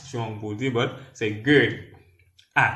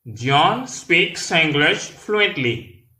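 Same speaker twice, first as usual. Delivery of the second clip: very slow, word by word, pitched higher; slow demonstration; 4 semitones higher, much slower than usual. Shown as a demonstration. Teacher models an English sentence for learners, very slowly. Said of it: "Là, nous parlons plus rapide".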